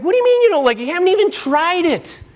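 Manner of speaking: high, loud, put-on exasperated parent voice. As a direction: play the fed-up parent pleading with a child. Parent arguing with picky child.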